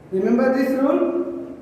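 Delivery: ordinary talk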